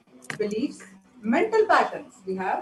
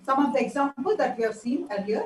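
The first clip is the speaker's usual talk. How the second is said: usual speaking voice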